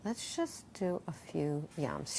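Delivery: soft, slow, gentle coaxing; calm her down gently; soothing invitation. Retold or imitated. Yoga teacher calming a panicking client on the phone, soothing. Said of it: "and I said".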